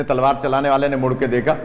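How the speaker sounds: normal talking voice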